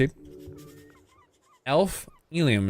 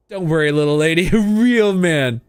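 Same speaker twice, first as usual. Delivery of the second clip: macho bravado; 3 semitones higher, 3 dB louder than usual. Acted. Mock macho boast.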